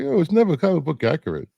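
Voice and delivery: high, quick imitation of whiny naysayers; mock complaint. Fan quoting the people who nitpicked comic book accuracy, mocking.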